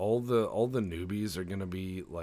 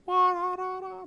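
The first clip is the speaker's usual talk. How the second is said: high, loud wah-da-da mock ray noise; mind ray sound; 8 dB louder than usual. Player voicing alien's paralyzing mind rays, high imitated zap. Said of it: "That's the sound of mind rays".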